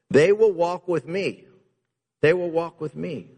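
a preacher crying out Jesus' promise; proclaiming. Acted. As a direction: lift it up like a promise from the pulpit.

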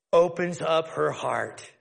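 ordinary talk, nothing marked

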